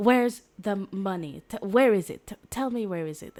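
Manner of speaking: loud, demanding interrogator shouting questions; angry interrogation. Acted. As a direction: bark it like a thug grilling a captive. Interrogator voiced demanding money.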